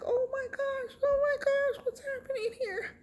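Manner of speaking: very high, tearful squeal; acted panic. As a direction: squeak it out as if terrified. Retells panicking at the sight.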